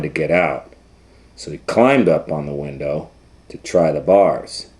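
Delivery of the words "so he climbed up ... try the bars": ordinary delivery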